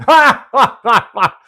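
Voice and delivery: high, loud scornful mock laugh; hammed-up derisive cackle; mocking laughter. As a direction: laugh him down like the jeering crowd. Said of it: "the crowd started laughing at him".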